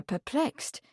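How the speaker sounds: plain reading voice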